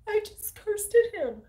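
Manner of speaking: high, slow whimpering confession; hammed-up guilty wail; mock panicked confession. Mimicking a colleague confessing to the principal, mock panicked whine. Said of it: "she tells on herself".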